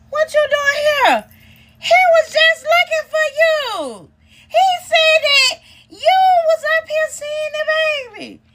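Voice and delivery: imitating flustered sister. Sister voiced caught off guard.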